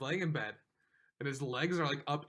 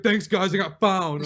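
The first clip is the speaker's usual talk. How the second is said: louder, higher cheeky thanks; mock loud announcement; 6 semitones higher, 13 dB louder, slower than usual. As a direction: shout the news back at friends. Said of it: "He was like".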